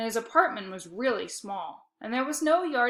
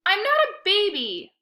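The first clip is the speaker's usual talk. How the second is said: much higher, louder indignant yelp; 10 semitones higher, 7 dB louder than usual. A narrator's reading. Boy yelping at his sister's taunt. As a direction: squeak out the protest. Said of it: "I yelped".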